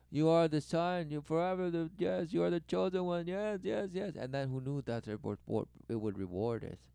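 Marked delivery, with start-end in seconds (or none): 0.1-4.2 louder, higher mock adulation, building; boastful praise. Parent boasting up a son, mock adulation. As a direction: heap praise on him, climbing in pitch. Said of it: "relentlessly boast them and say".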